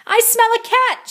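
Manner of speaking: high, loud bellow. A narrator's reading. Missus Begalot storming the lunchroom, loud bellow. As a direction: boom it down the hall. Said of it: "she bellowed".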